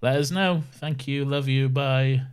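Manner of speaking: ordinary talk, nothing marked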